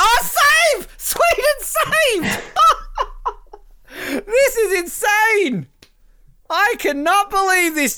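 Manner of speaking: high, loud yelling broken by laughter; real laughing-screaming fan; laughing excitement. Real, not acted. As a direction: shriek in disbelief at the save. Another save in shootout.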